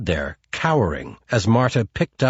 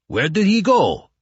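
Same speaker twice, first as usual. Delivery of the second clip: higher, alarmed question; agitated Chukov; 8 semitones higher than usual. Acted. Chukov on the phone demands answers from Marta, alarmed.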